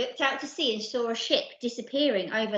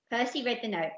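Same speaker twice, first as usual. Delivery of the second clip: usual speaking voice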